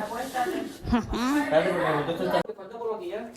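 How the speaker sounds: a real burst of laughter, the pitch rising; real laugh after the story. Real, not acted. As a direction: laugh at how absurd it is. Rire après l'anecdote des toilettes. (un vrai éclat de rire qui monte)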